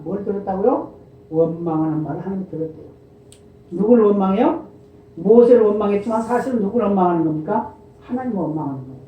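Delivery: ordinary delivery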